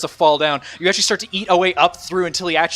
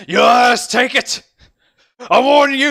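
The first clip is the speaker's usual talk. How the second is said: loud, high desperate shouting; cornered bandit yelling high and loud; threatening hostage-taker; 11 semitones higher, 9 dB louder, slower than usual. Acted. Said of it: "he goes like".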